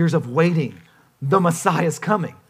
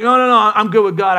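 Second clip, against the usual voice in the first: loud, higher-pitched brush-off; mock protest; 4 semitones higher, 7 dB louder than usual. Preacher mimicking believers who think they are fine, mock protest. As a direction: protest it like someone excusing themselves.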